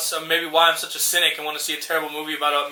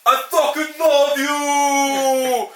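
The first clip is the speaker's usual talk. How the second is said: very slow, high, drawn-out battle yell; hammed-up shout; 7 semitones higher, much slower than usual. Boxer yelling as he charges his punch, hammed-up shout. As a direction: roar it as the big comeback punch lands. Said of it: "the guy will be like".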